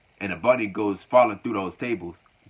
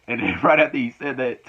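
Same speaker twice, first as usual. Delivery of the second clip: real laughter carrying the words; amused giggle, a bit higher; laughing; 4 semitones higher, 5 dB louder than usual. Real, not acted. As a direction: still giggling as he goes on. Laughing at the wrestling segment.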